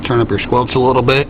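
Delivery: ordinary delivery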